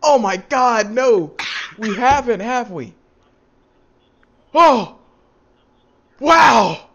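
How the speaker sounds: loud, high mock astonishment; hammed-up amazed exclamations, voice swooping down; sarcastic amazement. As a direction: overdo the shock, sarcastically. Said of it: "I'm just being sarcastic".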